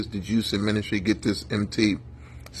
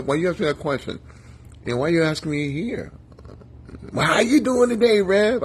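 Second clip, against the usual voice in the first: nosy gossiper; 5 semitones higher, faster than usual. Retold or imitated. Imitating a nosy gossiper in a story.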